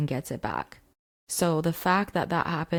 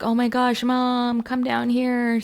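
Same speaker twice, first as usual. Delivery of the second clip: higher, louder urgent call to mom; 7 semitones higher, 4 dB louder, slower than usual. Retold or imitated. Son calling his mom to the flooded basement, urgent shout. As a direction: yell down the stairs for help. Sick son calls mother downstairs.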